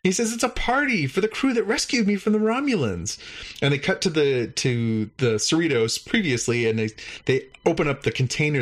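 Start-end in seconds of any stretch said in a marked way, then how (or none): 0.3-3.2 high cheerful alien voice; alien Klar. Retold or imitated. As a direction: pitch up into the chirpy alien.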